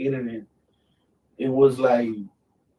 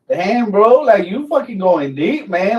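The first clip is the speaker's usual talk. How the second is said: half-laughing protest, pitched up; excited outburst; 8 semitones higher, 6 dB louder, slower than usual. Real, not acted. Interviewer asks deep question.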